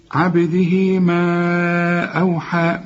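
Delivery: ما held very long before أوحى; four-count madd. Shown as a demonstration. Teacher models the separated madd, four-count madd. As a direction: slowly stretch ما on a level note, then أوحى. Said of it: "أربع حركات لأنه برضو مد منفصل".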